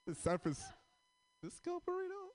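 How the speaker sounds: surprised. Real, not acted. Surprised by a huge burrito.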